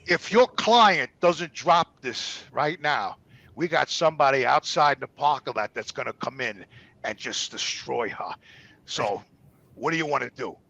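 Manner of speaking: slow, low, quiet warning; low menacing threat. Retold or imitated. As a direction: lean in and threaten softly. Lawyer's quiet threat retold.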